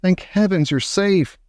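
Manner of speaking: high, heartfelt exclamation; relieved cry. A narrator's reading. Grandmother sees grandson is safe, high relieved cry. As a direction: let relief lift the voice. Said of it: "she cried".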